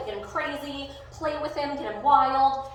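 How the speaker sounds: normal talking voice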